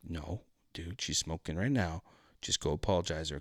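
low, hushed aside; soft, imitated confidential advice; discreet urging. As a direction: talk your cousin down quietly. Cousin quietly urges apology.

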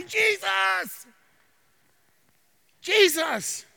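high, drawn cry of the name. Shown as a demonstration. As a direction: demonstrate calling out to Jesus. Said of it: "open your mouth and cry out and say".

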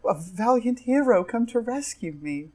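high, swooning storybook lady; damsel to be rescued. Acted. Lady greets her rescuer, high swooning damsel. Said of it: "She goes".

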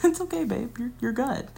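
pat her on the head with your voice; mock reassuring. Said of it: "And I'm like".

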